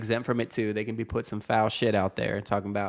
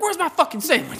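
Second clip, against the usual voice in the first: high, loud mock tantrum; imitated freak-out; 5 dB louder than usual. Angry customer demanding his order, imitated high outburst. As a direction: squawk the demand like a man losing it. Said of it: "apparently he flipped out".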